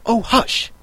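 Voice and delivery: high, nervous rabbit shushing; urgent high hush, mildly afraid; lightly voiced alarm; frightened whisper. A narrator's reading. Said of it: "whispered in a frightened tone".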